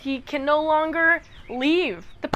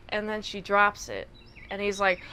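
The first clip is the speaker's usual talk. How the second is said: normal talking voice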